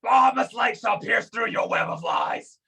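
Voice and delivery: high, declaimed holy threat; righteous battle cry. Acted. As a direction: proclaim divine judgment on a lying enemy. Holy warrior's battle cry at foe.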